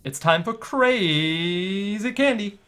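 announcer voice. Acted. Announcing the show segment.

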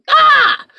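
very high, loud shriek; a cartoon scream of defeat; mock shriek. Dragon realising its lie fails, loud high mock shriek.

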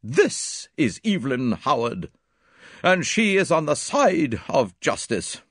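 high, emphatic declaration; stately, ringing self-assertion; proud. A narrator's reading. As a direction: declare it proudly, slow then building. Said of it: "flung up her head proudly".

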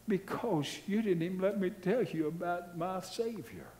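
acted-out weeping missionary; imitated weeping. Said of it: "He was audibly weeping".

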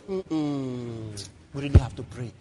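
low, humming worry; mock concern. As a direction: imitate resigned churchgoers fretting. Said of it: "that's our mentality".

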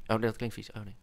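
usual speaking voice

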